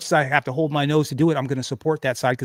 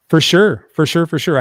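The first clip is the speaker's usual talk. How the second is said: loud, high emphatic agreement; bursting out in eager agreement; 4 semitones higher, 9 dB louder than usual. Real, not acted.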